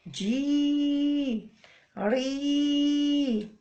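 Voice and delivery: long held جي and ري; drawn-out syllables. Shown as a demonstration. Teacher reviewing kasra syllables, held long. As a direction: stretch each syllable evenly. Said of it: "وما ننساش الكسرة".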